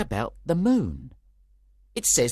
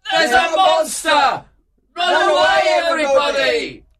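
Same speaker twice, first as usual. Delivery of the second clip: very high, loud alarm; panicked shouting; 7 dB louder than usual. Acted. The actors flee from donkey-headed Bottom, shouting in panic. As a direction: scream the warning to all. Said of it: "cried the actors".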